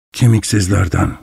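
low, hushed dread; fearful. Acted. Goodbody tells the traveller what he fears, low and hushed. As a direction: breathe the word with terror. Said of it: "korku vardı".

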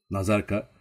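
ordinary delivery, nothing marked

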